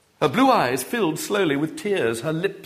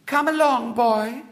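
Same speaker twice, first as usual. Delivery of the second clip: put-on high, sweet little-girl voice; a bossy little girl piping up; 11 semitones higher, slower than usual. A narrator's reading. Little girl ordering the boy about in a high voice.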